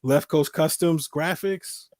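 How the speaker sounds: ordinary talk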